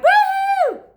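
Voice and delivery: high loud shout; rat crying boo. Acted. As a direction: yelp it out like a startled rat. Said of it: "who cried".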